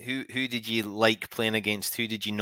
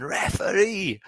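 higher, a mild mock yell at the referee; mock shout at ref; 7 semitones higher, slower than usual. [name] mimicking her dad at the telly, mild mock yell. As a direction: daughter imitating dad yelling at the ref. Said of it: "[name] does an impression of her dad shouting".

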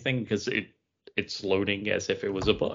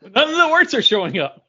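high, louder mock complaint; hamming up the outrage, pitched up; mock exclaim; 14 semitones higher, 9 dB louder than usual. To friends, a hammed-up high complaint. Joking about broken comic.

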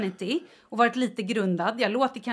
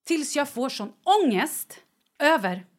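ordinary talk, nothing marked

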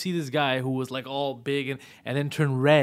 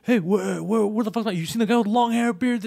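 retold yelling; 7 semitones higher than usual. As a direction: storm in hollering for your friend. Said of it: "walks into the bar yelling".

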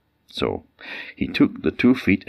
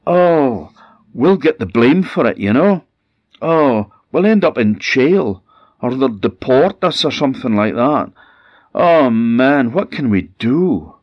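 panicked fretting; 8 semitones higher, 7 dB louder than usual. Acted. Couple panics about blame and jail.

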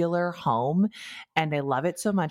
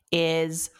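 slow deadpan; much slower than usual. Retold or imitated. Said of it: "says Kristen".

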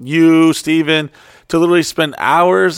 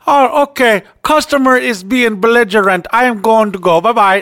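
mocking support guy; 8 semitones higher than usual. Retelling the agent who hung up, high mocking voice. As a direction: ham up the rude agent hanging up. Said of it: "He's like".

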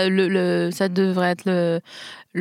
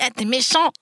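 put-on childish reproach; 4 semitones higher than usual. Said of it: "Le gars, tu lui dis".

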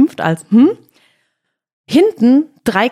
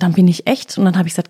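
ordinary talk, nothing marked

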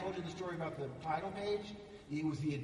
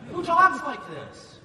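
much higher, much louder, slow incredulous cry; baffled outburst; 11 semitones higher, 16 dB louder, slower than usual. Retold or imitated. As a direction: throw up your hands at the dialogue. Actor baffled by script dialogue.

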